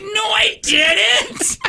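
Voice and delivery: very high, very slow shrieked denial; indignant kid shriek. Retold or imitated. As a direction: shriek the denial like a wronged little girl. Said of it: "and she's like".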